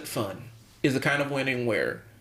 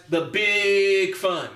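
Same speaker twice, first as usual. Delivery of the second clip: "big" stretched long and raised; sarcastic; 5 semitones higher, much slower than usual. Retold or imitated. Coach describing a hollow win for hardworking kids, sarcastic. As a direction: hold the word in mock enthusiasm. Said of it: "That's that sarcastic".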